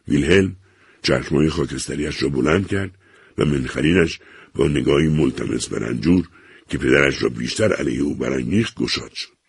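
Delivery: plain reading voice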